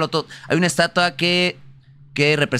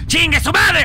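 angry curse; 12 semitones higher, 4 dB louder than usual. Real, not acted. Cursing at game.

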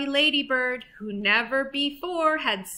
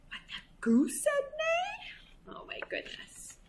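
hushed, breath-only aside; whispered amazement; 8 dB quieter than usual. Pretend. Reader reacts to the neighing goose, amazed whisper. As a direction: whisper it in mock astonishment.

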